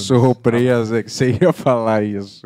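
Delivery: loud mock astonishment; sarcastic surprise. Teasing a partner's predictable verdict, sarcastic surprise. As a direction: feign being amazed, laying it on thick.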